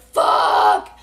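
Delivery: loud re-enacted panicked yell; retold scream. Rider seeing trees everywhere, re-enacted loud scream. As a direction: yell the curse out, long and loud. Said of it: "I'm screaming".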